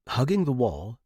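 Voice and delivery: ordinary delivery, nothing marked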